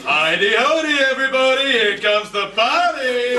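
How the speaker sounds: high, whooping party entrance; showy greeting. Acted. Uncle arriving at the party, high and showy.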